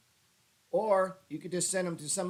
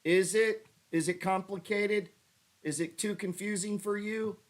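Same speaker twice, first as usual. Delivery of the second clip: mock taunting; 6 semitones higher, 4 dB louder than usual. Real, not acted. Mock-taunting the real estate friends.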